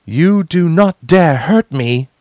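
high, loud and slow defiant call. A narrator's reading. Warning the whole crew, a high, loud, slow call. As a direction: call it out so everyone hears. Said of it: "she called, so everyone would hear".

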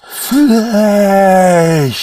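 high, loud, drawn-out creature snarl; growled. A narrator's reading. Demon snarling over its new body. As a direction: snarl the word like a hungry beast. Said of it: "it growled".

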